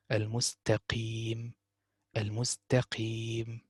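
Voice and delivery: "Al-Mustaqim" slowly, with a light, soft ta; slow correct pronunciation. Shown as a demonstration. Teacher slowly modelling the light ta in "Al-Mustaqim". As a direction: stretch "Al-Mustaqim" and keep the ta light. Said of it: "You have to pronounce the ta right".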